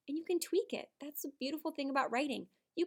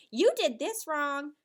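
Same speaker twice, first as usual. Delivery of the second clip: harsh critic; 4 semitones higher, 9 dB louder, slower than usual. Shown as a demonstration. Teacher models unkind feedback.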